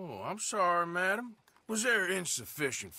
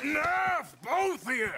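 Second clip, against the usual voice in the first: loud, higher bark to stop a fight; commanding shout; 12 semitones higher, 4 dB louder, slower than usual. Acted. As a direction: shut them both up. Man breaks up the fight.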